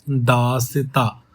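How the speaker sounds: दासता said very slowly, syllable by syllable, with a half-length स; slow pronunciation demo. Shown as a demonstration. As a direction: stretch out दासता so the half स is heard. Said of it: "pronounce करके देखो". (दासता को धीरे-धीरे, आधे स के साथ बोलना)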